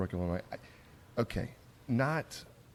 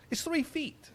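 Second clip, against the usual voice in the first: mocking high voice; slower than usual. Quoting the unhelpful bartender.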